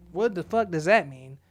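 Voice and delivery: ordinary talk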